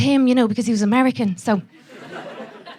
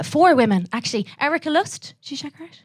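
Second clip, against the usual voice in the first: ordinary delivery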